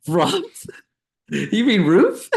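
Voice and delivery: high, slow, giggling "roof?"; mock-incredulous with a laugh; laughing disbelief. Friend mocking a Minnesotan's pronunciation, laughing. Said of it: "I was like".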